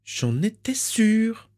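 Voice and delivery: very high, slow, drawn-out smug remark; smug aunt. Acted. Aunt reading the letter, slow and smug in high shrill voice. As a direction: savour being right. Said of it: "commenta-t-elle".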